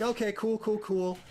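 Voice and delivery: a hammed-up "it's all fine"; mock cool. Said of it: "he played it really cool".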